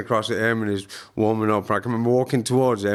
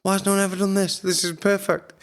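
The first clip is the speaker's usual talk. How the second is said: excited; 10 semitones higher than usual. Retold or imitated. Quoting his own excited thought.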